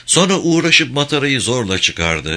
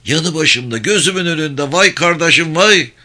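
higher, rising acted lament; grieving wail; 7 semitones higher, 4 dB louder than usual. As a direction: build to a loud, anguished cry for a lost brother. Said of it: "Ağlıyor, dövünüyordu". (ağıt yakar gibi, yükselen feryat)

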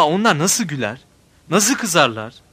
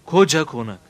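plain reading voice

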